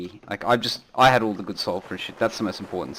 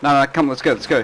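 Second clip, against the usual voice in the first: raised hurried urging; urgent callout; 4 semitones higher, 5 dB louder than usual. Real, not acted. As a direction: rush them to the boat. Hurrying team to boat.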